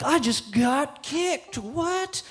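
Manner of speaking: high, drawn-out mock slow-motion voice. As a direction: stretch every word as in a slow-motion replay. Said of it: "Slow motion".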